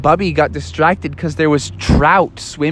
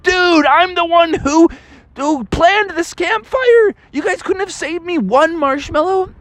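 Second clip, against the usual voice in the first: indignant Bubby; 12 semitones higher than usual. Acted.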